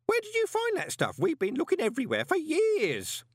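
put-on very high, eager old voice; bursting with surprise; excited old Groat. A narrator's reading. Groat amazed at the found uniform, excitedly. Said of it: "said Groat excitedly".

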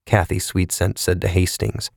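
ordinary delivery, nothing marked

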